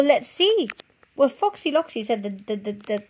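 ordinary delivery, nothing marked

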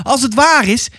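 high, anxious mother's cry; desperate. A narrator's reading. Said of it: "riep ze wanhopig". (wanhopig, met hoge stem)

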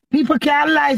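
ordinary talk

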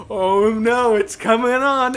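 loud, high hammed-up alarm; mock panic. Bandmate fakes a spasm before the class, mock panic. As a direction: fake the onset of an attack.

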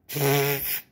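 spluttered lip-burst "pff"; long puff of held-back laughter; snort of laughter. A narrator's reading. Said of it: "Nelly proest het uit".

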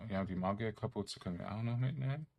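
ordinary talk, nothing marked

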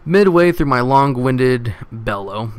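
ordinary talk

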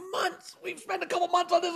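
high-pitched mock whine of frustration; frustrated. Venting to spouse after the buyer stalls, mock-frustrated squeal. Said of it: "we're frustrated 'cause".

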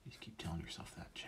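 fast sarcastic whisper. Real, not acted. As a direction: mutter a jab at yourself. Self-mocking aside.

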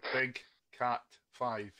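slowly repeated call sign. Real, not acted. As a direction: say it slowly and clearly. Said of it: "Uh, more slow".

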